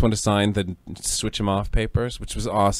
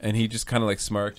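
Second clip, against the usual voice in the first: ordinary delivery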